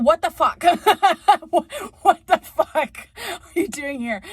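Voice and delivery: loud, high, laughing outrage; shouted what the fuck with a laugh; outraged. Real, not acted. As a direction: blurt it out in disbelief. Mock outrage at random American.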